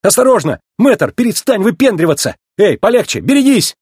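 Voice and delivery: high, urgent warnings; alarmed calling. A narrator's reading. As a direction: cry out to stop a reckless driver. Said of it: "крикнул Маккуин виляющему эвакуатору".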